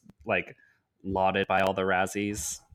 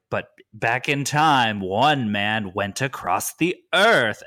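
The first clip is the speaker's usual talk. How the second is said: slow, loud, dramatic trailer narration; horror trailer voice; 5 dB louder, slower than usual. Retold or imitated. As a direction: boom it out like an epic movie-trailer announcer. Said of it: "And it's like".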